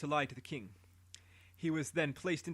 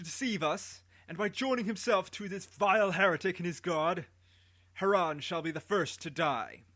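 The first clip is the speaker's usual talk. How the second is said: higher, louder royal sentence; booming voice; 4 semitones higher, 3 dB louder than usual. Acted. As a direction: pronounce the death sentence to the people. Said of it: "in a great booming voice".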